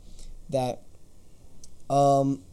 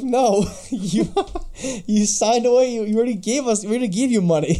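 high, loud protest breaking into laughter; mock indignant refusal; 9 semitones higher, 7 dB louder than usual. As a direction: play an exasperated studio saying no, laughing. Acting out the studio refusing him.

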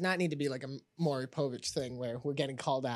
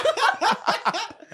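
high burst of laughter; 7 dB louder than usual. Real, not acted. Laughs at the title joke.

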